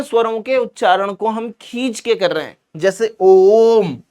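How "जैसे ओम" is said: ओम stretched slow and long; ओम chanted long; drawn-out om. Shown as a demonstration. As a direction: hold the ओ in ओम. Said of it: "ओम कैसे बोलते हैं हम".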